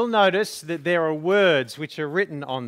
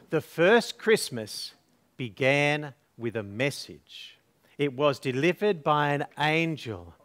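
soft low storytelling voice; story narration; 6 semitones lower, 6 dB quieter than usual. Acted. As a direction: tell it gently to children. Reads the nativity story.

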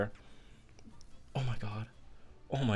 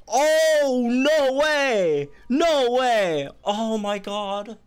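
high, loud cries of delighted disbelief; can't believe the upgrade is real; excited disbelief; 10 semitones higher, 5 dB louder, much slower than usual. Real, not acted. Gamer reacting to a new upgrade, thrilled shouts.

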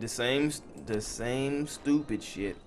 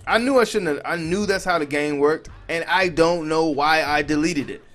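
loud, higher-pitched frustrated venting; exasperated self-blame; 5 semitones higher, 7 dB louder than usual. Real, not acted. Player who deleted his own district, exasperated. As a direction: beat yourself up out loud, loudly.

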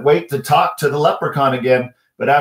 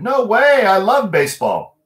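put-on much higher, louder boyish burst; eager protest; 10 semitones higher, 4 dB louder than usual. Michael refusing to quit baseball, eager. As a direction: blurt it out like a keen kid.

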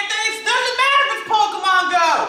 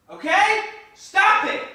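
ordinary talk

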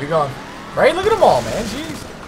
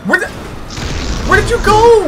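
startled; 14 semitones higher, 4 dB louder than usual. Real, not acted. Lost friend in game.

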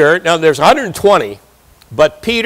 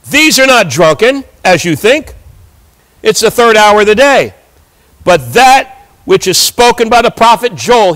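higher, emphatic proclamation; bold declaring; 4 semitones higher, 4 dB louder than usual. Acted. Peter answering those who think them drunk, boldly declaring. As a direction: declare it firmly to a crowd. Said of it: "we see him boldly declaring".

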